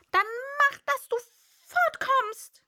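high, loud grumpy bird; peevish. A narrator's reading. Pigeon crossly sending Alice away, high and loud. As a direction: shoo her off, cross. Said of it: "sagte die Taube verdrießlich".

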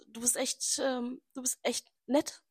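soft, slow mock compliment; softly drags out du bist echt nett; imitating him softly. Quoting his compliment, she softly drags it out. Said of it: "und sagt so".

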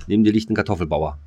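normal talking voice

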